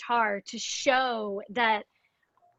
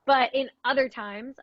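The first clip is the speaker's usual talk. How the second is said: usual speaking voice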